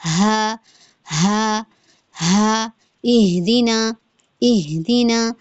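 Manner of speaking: ہا heavy, chest vibrating, breath out; slow, airy ہا in اہدنا; اہدنا with a heavy breathy ہ. Shown as a demonstration. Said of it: "اکثر حلق سے ادا ہوتا ہے".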